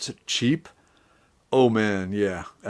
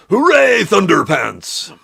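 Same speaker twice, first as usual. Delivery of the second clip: mock heroic cheer; 10 semitones higher, 6 dB louder, slower than usual.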